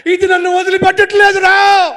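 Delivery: loud desperate cry; man shouting. Acted. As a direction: cry out trapped in the water. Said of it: "అరుస్తున్నాడండి". (చిక్కుకుపోయిన మనిషి అరుపు)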